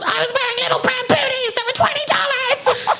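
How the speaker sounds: very high, wailing mock sob; over-the-top imitation of a teary voicemail; mock hysterical crying. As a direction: squeal it out as if sobbing, hammed-up. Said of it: "Hysterically crying".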